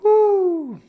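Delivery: high loud joyful woo; excited whoop. Shown as a demonstration. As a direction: let out a big happy whoop. Energetic stretch release.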